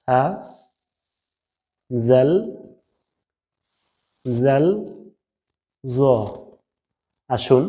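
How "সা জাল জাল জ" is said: সা জাল জ said slowly, tongue tip on the upper front teeth; spaced-out recitation of সা, জাল, জ; letter pronunciation demo. Shown as a demonstration. Teacher modelling Arabic letters for students, slow and careful. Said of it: "মাথায় বা আগায় লাগিয়ে".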